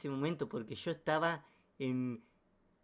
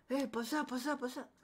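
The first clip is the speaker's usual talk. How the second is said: quick, high mock urging; high-pitched acted urging; 9 semitones higher than usual. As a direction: imitate someone at the door, pitched high. Voice at the door, acted.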